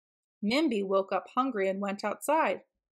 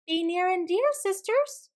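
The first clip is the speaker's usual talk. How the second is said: high, lilting call to sisters; high-pitched small-animal voice; little dassie calling; 8 semitones higher than usual. A narrator's reading. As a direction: sing out sweetly high, rising at the end. Dassie calls to her sisters.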